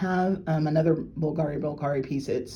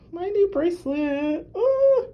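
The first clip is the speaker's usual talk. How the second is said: very high, slow, near-tearful coo; teary delight; 5 dB louder, slower than usual. Real, not acted. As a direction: coo over it, almost crying. Admiring new bracelet.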